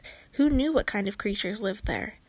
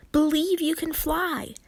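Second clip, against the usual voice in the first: urgent call; 5 semitones higher, 3 dB louder than usual. Acted. Tink still shouting.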